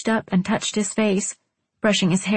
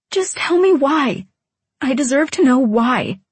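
high, raised-voice plea for an answer; loud demand; 6 semitones higher, 5 dB louder than usual. A narrator's reading. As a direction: demand it loudly, pitched up. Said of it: "I said loudly".

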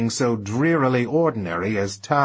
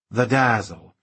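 ordinary delivery, nothing marked

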